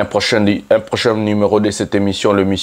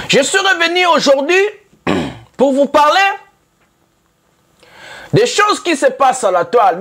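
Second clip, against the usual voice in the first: rousing talk-show harangue; fired-up declamation. Acted. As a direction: call out the fans from the pulpit. Host launches into heated address.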